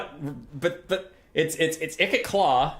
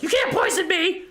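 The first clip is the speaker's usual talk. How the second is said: much higher squealing shout; paranoid rat shriek; 3 dB louder than usual. Retold or imitated. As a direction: scream it like a paranoid rat warlord. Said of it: "'cause he's like".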